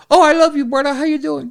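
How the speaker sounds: high voice, suddenly friendly; Iron Sheik impression. Sheik recognising Rob online, friendly impression. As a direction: switch to affectionate greeting in high wrestler voice. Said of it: "And he goes".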